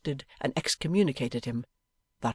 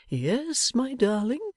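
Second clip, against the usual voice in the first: doting Pa answering his daughter; fond father; 10 semitones higher, slower than usual. Acted. Pa answers Bella.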